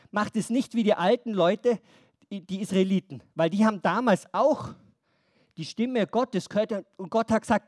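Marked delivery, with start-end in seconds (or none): none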